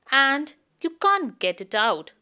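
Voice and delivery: usual speaking voice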